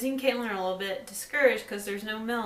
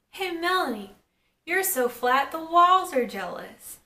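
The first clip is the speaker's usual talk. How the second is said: higher, jeering schoolboy jab; mock taunt; 7 semitones higher, 3 dB louder than usual. Joey heckling Melanie from the bleachers, higher, a mocking jeer. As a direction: sneer it out like a class clown.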